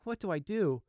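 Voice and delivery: put-on high, worried young voice; a frightened young buck asking himself; anxious young deer.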